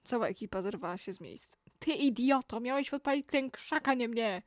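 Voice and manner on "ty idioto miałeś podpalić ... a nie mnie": a raised, furious yell at the idiot who set him on fire; angry yell. Acted. Burned by a bungling helper, he yells in fury. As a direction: shout it, higher and louder. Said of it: "usłyszeli znajomy krzyk za krzakami".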